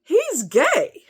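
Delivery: exasperated retort. Retold or imitated. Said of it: "I said".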